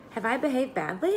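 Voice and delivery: genuinely taken aback; astonished. A narrator's reading. Pippi asking the teacher, astonished and innocent. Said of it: "much astonished".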